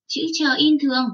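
normal talking voice

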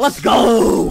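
loud victory shout; hyped-up gamer cheer. Real, not acted. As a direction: yell it in triumph. Celebrating a good move.

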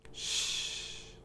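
soft, voiceless shush; long, low-volume shh. A narrator's reading. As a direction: hush someone with a quiet "shh". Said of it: "tries to shush me".

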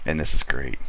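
ordinary talk

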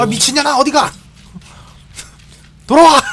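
high shout, rising to a yell. Real, not acted. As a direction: yell at her to come back. Yelling at fleeing teammate.

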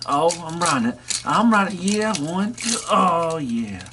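high whooping rider; excited rodeo toy. Pretend. The toy cheers while riding the other, high whooping.

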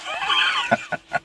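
a sudden high whooping laugh; shrill mocking laugh. Blackmailer mocking the journalist with a shrill whooping laugh.

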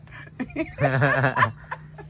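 low laugh trailing down; long amused laugh. Real, not acted. Recalling the staff running from the prank, long low laugh. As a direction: laugh helplessly at your own mischief.

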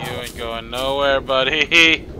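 playful threat building loud; mock menacing. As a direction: swell to a big, loud BUDDY. Repeats threat, swelling louder.

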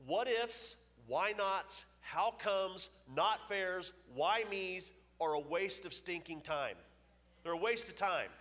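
preacher driving home a lesson to the crowd; forceful preaching. Real, not acted. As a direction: pound each phrase like a preacher. Preacher hammering home his point.

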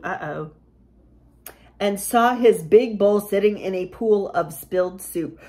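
usual speaking voice

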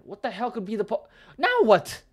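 very high, loud shout of disbelief; shocked outburst. Real, not acted. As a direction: explode in sudden shock. Shocked reaction while reading story.

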